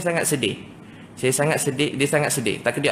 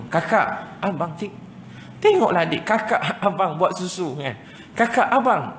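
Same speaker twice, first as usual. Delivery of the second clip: put-on parent calling children; 4 semitones higher, slower than usual. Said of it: "saya pun kadang-kadang begitu".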